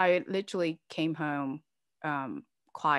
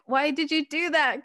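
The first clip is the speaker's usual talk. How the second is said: exasperated quote; 10 semitones higher, 7 dB louder than usual. Retold or imitated. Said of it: "because I'm like".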